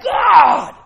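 loud, high call to God; crying out. Retold or imitated. Jabez crying out to God, loud call. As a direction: shout God to be heard. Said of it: "He's like".

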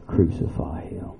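ordinary talk, nothing marked

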